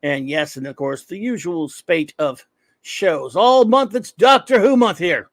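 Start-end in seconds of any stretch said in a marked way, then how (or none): 3.4-5.3 loud and higher, hyped yelling. Real, not acted. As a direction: shout it to the whole chat with excitement. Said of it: "I'm yelling at you all for no reason".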